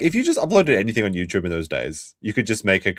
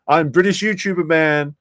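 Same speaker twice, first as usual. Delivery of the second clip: lightly mocking British YouTuber, pitched up; higher, teasing self-introduction; 7 semitones higher than usual. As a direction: play up being the British YouTuber a little. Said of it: "when people are like".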